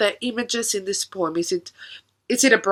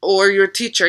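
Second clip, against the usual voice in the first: normal talking voice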